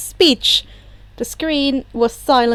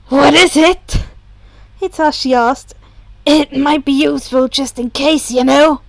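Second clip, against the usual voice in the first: a lightly voiced, louder, curious Hitoshi; Hitoshi's voice; 5 dB louder than usual. A narrator's reading. Hitoshi asking Shoto what else he knows, lightly voiced, louder.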